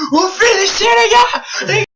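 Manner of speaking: high, loud yell at the team. Retold or imitated. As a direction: yell at them to finish it. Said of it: "j'arrêtais pas d'hurler".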